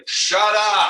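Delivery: shouting. Retold or imitated. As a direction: bark it out, laughing. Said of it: "stand up and say".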